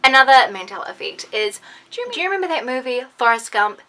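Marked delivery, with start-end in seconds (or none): none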